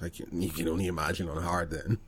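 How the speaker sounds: ordinary delivery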